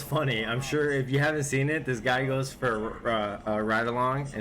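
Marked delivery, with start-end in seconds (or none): none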